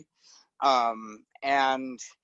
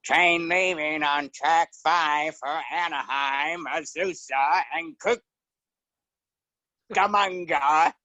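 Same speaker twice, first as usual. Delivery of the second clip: very slow, drawn-out station announcement; train announcer; slower than usual. Acted. Guest doing an old radio comedy bit, disembodied train announcer. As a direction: stretch each stop out, pausing before the last. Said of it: "a disembodied train announcer".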